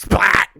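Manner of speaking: loud, high comic splat; imitated splat. Bullet cuts off the general's boast, imitated splat. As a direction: blurt out the bullet's impact.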